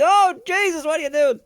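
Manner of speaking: hammed-up mechanic yelling at a bad repair; imitated shout. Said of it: "mechanics shouting at me saying".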